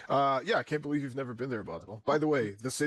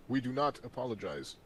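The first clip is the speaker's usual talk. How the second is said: ordinary delivery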